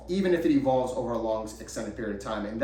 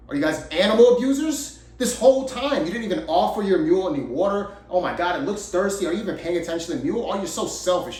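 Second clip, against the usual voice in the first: high, loud mock outrage that eases slightly; chastising traveler; 5 semitones higher, 5 dB louder than usual. Travelers accuse father and son over the mule, chastising. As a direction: act out an appalled passerby's scolding, pitched up. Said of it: "they chastised them".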